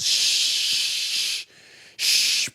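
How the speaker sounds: a long soft unvoiced sh-; rain hush. Shown as a demonstration. Teacher imitating rain for a letter. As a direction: hiss sh- softly like rain falling. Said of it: "it's raining".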